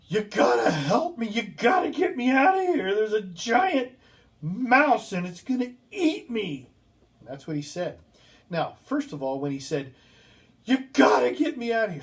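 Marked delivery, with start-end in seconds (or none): 0.1-2.9 high, loud, frantic yelling; retold panicked yell. Patient yells through the window for help in a panic. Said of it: "and he yells". 2.9-6.7 high, loud, terrified shouting; retold panicked yell. Patient yells to the nurse about the giant mouse in a panic. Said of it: "and he yells". 10.6-12.0 high, loud, frantic shout; repeated panicked yell. Retold or imitated. Storyteller replays the patient's yell through the door, frantic. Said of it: "and he yells".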